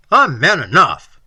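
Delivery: loud, defiant assertion; forceful, fighting retort; fierce. A narrator's reading. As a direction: fling it back at him fiercely. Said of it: "said I fiercely".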